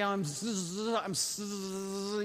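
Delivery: stuck on a drawn-out buzzing sound; Fonzie. Retold or imitated. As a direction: struggle and fail to get the word out. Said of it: "he could never say, "I'm sorry".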